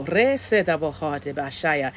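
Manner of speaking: slow chanted made-up syllables; light language. Acted. Psychic speaking light language to clear space, slow chant. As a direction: intone the syllables slowly like a chant. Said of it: "speaking in my light language".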